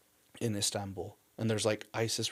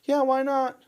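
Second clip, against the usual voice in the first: much higher, louder breezy agreement; imitating the wife; 11 dB louder than usual. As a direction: toss it off brightly as the wife. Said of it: "And she's like".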